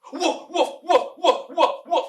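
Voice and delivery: high, loud mock woofs; dog barking. Teacher jokingly getting the cow's sound wrong, high loud woofs. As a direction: bark like an excited dog. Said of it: "the cow goes".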